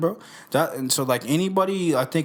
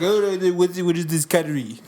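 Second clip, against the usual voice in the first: mock Putin; 3 semitones higher than usual. Host pretending Putin finds the podcast, lightly voiced baffled Putin. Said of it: "it's gonna be Putin".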